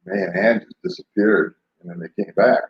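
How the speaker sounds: ordinary delivery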